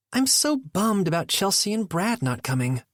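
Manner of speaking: complain, a bit put out. A narrator's reading. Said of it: "Nora complained".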